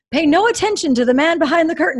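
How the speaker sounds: high, loud and fast, laughing through it; mock Wizard of Oz line through laughter; laughing quote. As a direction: toss off the famous line with a laugh. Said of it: "I told him, I'm like".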